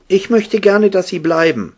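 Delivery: put-on very high little-girl voice; Elizabeth's pleading. A narrator's reading. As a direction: ask them to stay in a high, small voice. (hohe Mädchenstimme, bittend)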